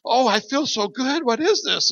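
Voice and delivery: very high, loud and slow with joy; overjoyed niece. Retold or imitated. Fighter niece feeling healed, very high joyful exclaim. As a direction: burst out in delighted wonder.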